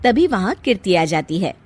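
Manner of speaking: ordinary talk, nothing marked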